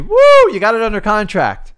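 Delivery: loud, high whoop, then cheering; hammed-up congratulatory cheer; mock celebration. Imitates trainer high-fiving over contract.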